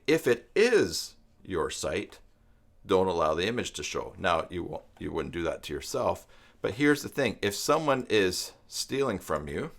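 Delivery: usual speaking voice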